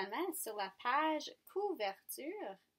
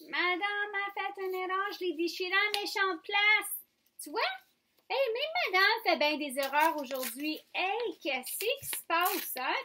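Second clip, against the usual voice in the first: higher-pitched, playful fretting; mock dismay; 6 semitones higher, 4 dB louder than usual. Teacher admitting a mistake to pupils, high mock dismay. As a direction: fuss out loud over your own blunder.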